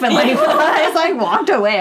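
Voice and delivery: laughing through the words; high, giggling retelling. Real, not acted. Dancer laughing as she tells her friend how she left. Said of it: "laughs while telling".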